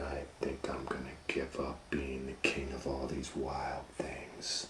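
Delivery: hushed, secretive reading; story whisper. A narrator's reading. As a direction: whisper it softly.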